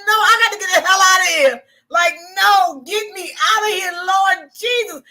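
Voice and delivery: loud, high mock cry for rescue; hammed-up desperate plea. Recalling wanting out of there, hammed-up desperation. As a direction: wail it like a comic prayer to get out. Acting out past wish to escape.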